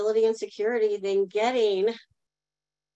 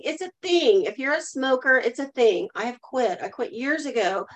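ordinary talk, nothing marked

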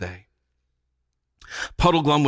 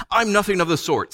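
put-on higher-pitched girlish protest; indignant; 8 semitones higher than usual. A narrator's reading. Jill stamping her foot at the witch, indignant. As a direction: flatly refuse the flattery.

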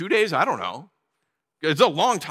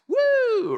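mock cheer. Mock cheer for seeing grass.

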